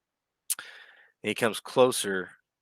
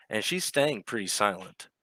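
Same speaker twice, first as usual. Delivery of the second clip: usual speaking voice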